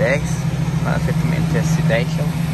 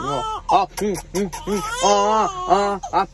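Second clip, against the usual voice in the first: high hushing hums to calm her; 7 semitones higher, slower than usual. Real, not acted. Father hushing crying baby.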